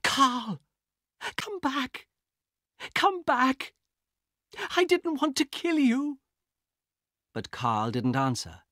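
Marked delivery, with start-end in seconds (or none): 0.0-0.6 high anguished call; horrified sister. Acted. Elena after stabbing him. 1.3-2.1 broken plea. Acted. Elena pleads to dead brother. 2.9-3.7 high, loud anguished plea; anguished cry. Acted. Elena pleads to dead brother. 4.8-6.2 high, loud grieving cry; anguished grief. Acted. Elena grieving over body.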